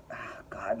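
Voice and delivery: exasperated whisper under her breath; soft unvoiced oh God; whispered aside. Real, not acted. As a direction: breathe it out, fed up. Exasperated aside mid-sentence.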